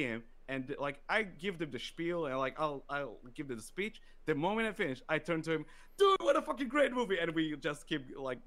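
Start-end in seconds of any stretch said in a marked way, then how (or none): 6.0-7.2 high and loud, bursting with joy; excited exclamation. Retold or imitated. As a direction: gush to your friend about the movie. Turning excitedly to his friend.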